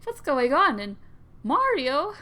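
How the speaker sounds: loud high alarm; alarmed shout. Acted. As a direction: burst in shouting. Luigi arrives shouting.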